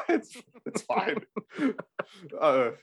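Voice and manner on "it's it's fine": laughing. Real, not acted. Laughing at the co-host's joke.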